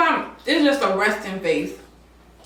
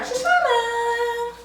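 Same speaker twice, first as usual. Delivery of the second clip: drawn-out name announcement; 13 semitones higher, much slower than usual. Acted. Announcing her show name.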